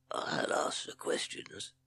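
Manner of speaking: slow, whispered snake hiss; Hissing Sid. A narrator's reading. Snake cutting off his captive, slow hiss. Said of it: "hissed Sid".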